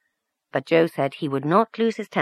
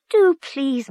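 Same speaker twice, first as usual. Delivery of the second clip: high, bright coaxing plea; coaxing hostess; 11 semitones higher, 5 dB louder than usual. Acted. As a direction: coax her sweetly into singing. Mrs Donnelly coaxing Maria to sing.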